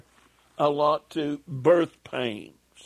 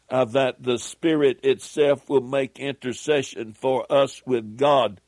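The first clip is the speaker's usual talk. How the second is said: ordinary talk, nothing marked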